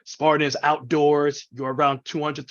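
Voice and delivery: normal talking voice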